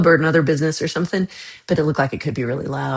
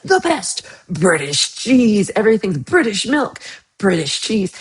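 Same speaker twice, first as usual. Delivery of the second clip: mock advertising hype; 3 semitones higher than usual. Mimics boastful British cheese labelling.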